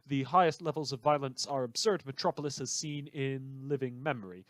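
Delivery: ordinary talk, nothing marked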